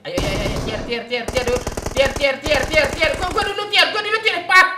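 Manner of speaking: rising, desperate pleading; frantic urging. Real, not acted. Begging to be revived first.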